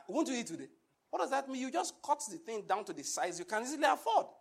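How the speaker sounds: usual speaking voice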